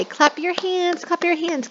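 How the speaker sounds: put-on playful high kid-teacher chant; sing-song command. Shown as a demonstration. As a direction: lead the class to clap. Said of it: "and then you say".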